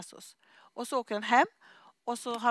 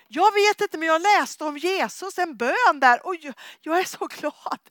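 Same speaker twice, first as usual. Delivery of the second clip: higher, louder, bursting with joy; overjoyed; 6 semitones higher, 9 dB louder than usual. Retold or imitated. Husband tells his wife he read about Jesus, overjoyed and much higher. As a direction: gush it out giddy and amazed.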